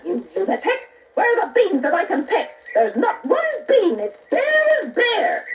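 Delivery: high, scornful mother, half laughing; mock her son with a laugh; Jack's mother scoffs at the bare beanstalk; scolding mother. A narrator's reading. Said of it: "The mother said".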